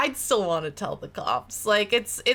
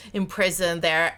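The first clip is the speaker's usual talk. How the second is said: usual speaking voice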